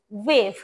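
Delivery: "ওয়েভ" low, opening with a v-tinged w; slight v effect. Shown as a demonstration. Teacher modelling for pupils, "ওয়েভ" starting slightly like v. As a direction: say "ওয়েভ" as vwev, falling. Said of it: "ভয়ের মতো উচ্চারণ করতেছি".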